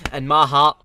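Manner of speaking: sudden booming shout; loud declaration. Acted. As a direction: bellow it out from the heart. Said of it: "Maybe that was a bit too far".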